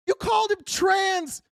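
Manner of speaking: furious accusing yell; angry shout. Real, not acted. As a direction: hurl it at them slowly. Ranting, shout heard.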